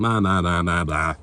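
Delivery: ordinary talk, nothing marked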